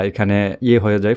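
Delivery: ordinary talk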